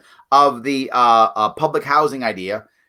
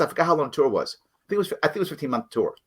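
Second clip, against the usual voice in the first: ordinary talk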